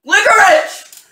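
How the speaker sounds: loud, high victory shouts; excited shouting. Real, not acted. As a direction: cheer like you just won. Celebrates getting the good bean.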